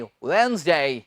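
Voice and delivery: वेडनेसडे as slow two-beat WENZ-day, silent D; correct pronunciation. Shown as a demonstration. Teacher models वेडनेसडे for students, careful correct wenz-day with a long glide. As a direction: draw out वेडनेसडे as wenz-daay, falling. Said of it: "डे को क्या कहना है?".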